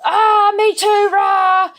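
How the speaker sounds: very high, loud, slow mock dog outburst; dog joining in. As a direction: yelp it like an excited dog going off. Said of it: "our dog ... goes".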